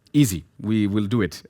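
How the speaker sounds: ordinary talk, nothing marked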